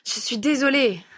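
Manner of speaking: high, breathless apology; out of breath. A narrator's reading. Grandmother arrives after chasing the boy, out of breath. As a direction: apologise while catching your breath. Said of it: "dit-elle en arrivant essoufflée".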